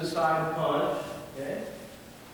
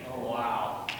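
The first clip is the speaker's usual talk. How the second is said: normal talking voice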